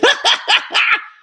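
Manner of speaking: loud high breathy cackle; Kamala-style high cackle. Shown as a demonstration. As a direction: cackle wildly in character.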